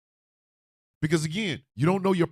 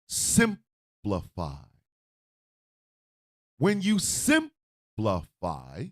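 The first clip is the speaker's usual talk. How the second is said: drawn-out slow emphasis; 10 semitones lower, 3 dB quieter, much slower than usual. Acted. To the audience, slowly drawing out the word. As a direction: stretch simplify out slowly, lower. Slowed explanation of simplify.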